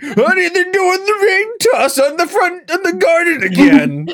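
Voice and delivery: put-on high, far-off grumpy old man. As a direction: whine it to your wife like an old codger. Old man calling to wife.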